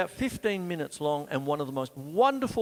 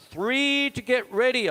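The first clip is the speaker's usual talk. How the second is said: announcing tune; 10 semitones higher, 8 dB louder than usual. Shown as a demonstration. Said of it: "I've got to go to brushes here".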